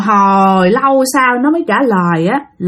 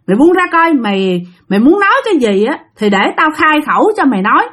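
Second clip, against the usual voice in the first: higher, commanding exorcist's voice; raised voice of a ritual master; stern shaman; 4 semitones higher than usual. Retold or imitated. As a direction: order the spirit to let go. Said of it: "ông thầy mới nói rằng".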